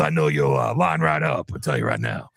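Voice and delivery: very low put-on pitchman voice; deep fake pitchman voice; mock grifter. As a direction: sell it like a sleazy deep-voiced huckster. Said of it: "mocking gold-selling grifter".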